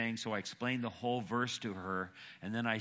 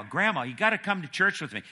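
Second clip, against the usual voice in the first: louder, higher, quicker granddaughter's plea; persistent pleading; 9 semitones higher, 9 dB louder, faster than usual. Retold or imitated. Granddaughter urging Grandma to come to church, persistent. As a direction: press Grandma insistently. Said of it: "Granddaughter was really persistent".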